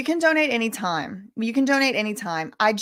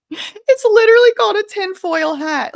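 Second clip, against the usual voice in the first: laughing; 13 semitones higher, 10 dB louder than usual. Real, not acted. Laughing shriek over hat name.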